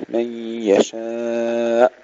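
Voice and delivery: slow chant, "يشاء" drawn out to a clean stop; still final hamza. Shown as a demonstration. Teacher recites the verse ending, unbounced hamza. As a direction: stretch "يشاء" and shut off on ء. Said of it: "الهمزة أيضًا لا نقلقلها".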